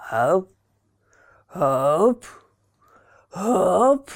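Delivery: ap, ap, ap, wheezing toward a sneeze; gasping before a big sneeze; hammed-up sneeze build-up. A narrator's reading. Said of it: "завыл Карабас-Барабас".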